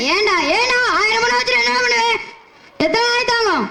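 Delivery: high-pitched, comic lament; comic wailing. Acted. Poor poet alone, bemoaning his troubles, in a high comic wail. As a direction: wail it high like a hammed-up mourner.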